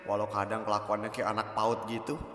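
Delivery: soft murmured inner thoughts; muttering her thoughts to herself; talking to herself. Acted. (bergumam pelan sendiri)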